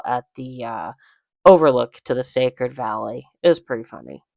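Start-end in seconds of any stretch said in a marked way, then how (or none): none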